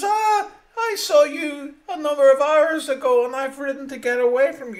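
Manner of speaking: loud, high and rushed, fast words tumbling; frantic outburst. Acted. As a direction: blurt it out fast, still fleeing. Man confronts death, breathless.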